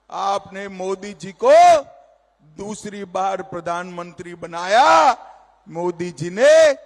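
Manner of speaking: ordinary talk, nothing marked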